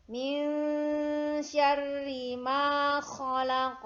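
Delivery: slow, loud melodic chanting; Quran recitation. Shown as a demonstration. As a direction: chant it slowly like murottal. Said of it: "Ayat yang kedua".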